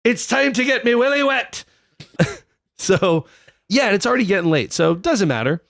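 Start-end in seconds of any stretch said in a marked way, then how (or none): 0.0-1.6 put-on high-pitched comic Scottish accent. King eager to reach his new wife, in a Scottish accent. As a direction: crow it in a broad Scottish accent. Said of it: "I gotta do that in a Scottish accent".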